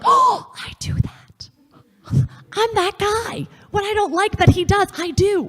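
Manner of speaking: high, slow, breathy gasp of realization, fading down; shocked realization. Retold or imitated. To the women, realizing she's that guy: high, slow, breathy shocked gasp. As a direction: gasp it out as the penny drops.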